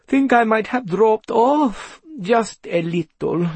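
sleepy cat, high and drawn out; yawning. A narrator's reading. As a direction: stretch the words through a big yawn. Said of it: "She yawned widely again".

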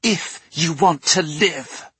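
put-on high, threatening girl's snarl; menacing snarl. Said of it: "she snarled".

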